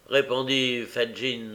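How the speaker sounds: plain reading voice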